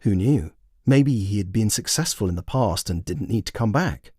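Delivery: plain reading voice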